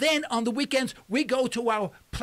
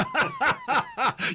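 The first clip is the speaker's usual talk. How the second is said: higher burst of laughter; hearty laugh; 6 semitones higher than usual. Real, not acted. Host cracking up after ribbing the caller, hearty laugh.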